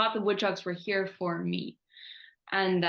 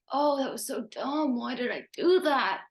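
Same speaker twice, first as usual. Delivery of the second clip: self-scolding; 5 semitones higher than usual. Retold or imitated. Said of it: "And I was like".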